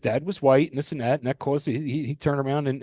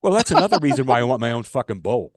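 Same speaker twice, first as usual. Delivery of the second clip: ordinary talk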